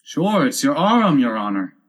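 "Arum" said in two syllables; servant's two-syllable "Arum"; dialect "Arum" for arm. A narrator's reading. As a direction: say arm as "Arum", with an extra vowel. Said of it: "He pronounced it Arum".

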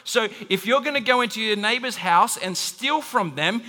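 usual speaking voice

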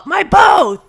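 very high, loud insistent shout; patient insisting to the nurse, angry shout. Retold or imitated. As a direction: shout it back at her. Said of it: "And she's all".

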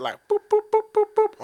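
squeaky mock boop noises, pitched very high. Mimicking kegel squeezes for the podcast hosts, squeaky high boops. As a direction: chirp each boop like a toy squeak.

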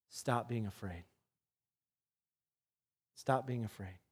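soft, low, gentle command; calm them with a hushed order; quiet command. Acted. Jesus commanding fearful disciples.

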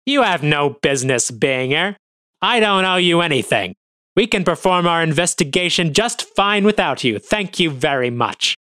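sneering. Acted. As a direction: brush them off with scorn. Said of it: "Astorga sneers at us".

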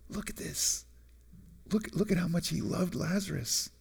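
very soft, awed murmur; retold in a near whisper; hushed onlookers. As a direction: murmur it like a bystander at a graveside. Voicing onlookers watching Jesus weep.

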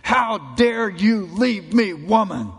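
a loud, slow, hammed-up rebuke; mock thundering anger. God voiced as angry, accusing his unfaithful bride. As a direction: thunder it like a wronged judge. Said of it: "God thundering out in judgment and anger".

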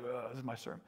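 hushed, fed-up mock grumble; mock groan. Pastor imitating a grumbling preacher, hushed. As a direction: mutter it like a weary preacher. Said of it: "and, like".

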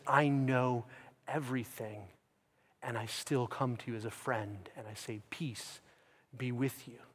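very soft, low, gentle voice; tender reassurance. Retold or imitated. Jesus comes as a friend to failing disciples, tender reassurance. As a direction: reassure quietly, as a forgiving friend.

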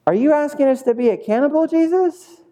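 fast, high-pitched mock outrage; comically scandalized listener; appalled disbelief. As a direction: blurt it high, rising to a squeak. Acted crowd appalled at Jesus' words.

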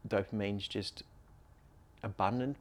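normal talking voice

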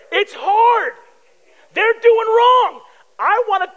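high, loud mock exasperation; exasperated outburst. Preacher acting out his road frustration, exasperated and loud. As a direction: blurt it out, straining at their wrongdoing.

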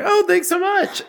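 very high, sweet thank-you; high chirpy mock voice; voice from the elevator. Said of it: "this voice coming from the elevator going".

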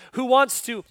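ordinary talk, nothing marked

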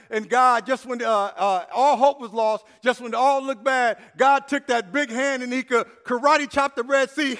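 loud, building sermon climax; preaching at full force. Acted. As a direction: preach it, lifting the crowd.

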